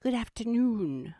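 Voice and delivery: high, drawn-out Australian greeting; exaggerated Australian host welcome; Mama Meow's Australian voice. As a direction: hail them in a hammed-up Aussie accent. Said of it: "Now I'm gonna do Mama Meow".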